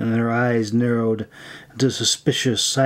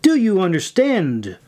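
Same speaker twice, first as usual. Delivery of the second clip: higher, insistent demand; forceful, raised-pitch insistence; louder repeat; 6 semitones higher than usual. A narrator's reading. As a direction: press the question harder, pitch jumping up. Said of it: "repeated louder this time".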